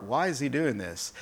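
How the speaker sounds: muttering it in their heart; the Pharisees asking silently, quietly questioning; quiet inner why. Retold or imitated. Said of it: "in their heart".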